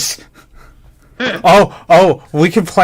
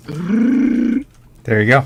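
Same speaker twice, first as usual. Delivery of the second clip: Wookiee growl; much slower than usual. Shown as a demonstration. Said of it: "You just sounded like a Wookiee".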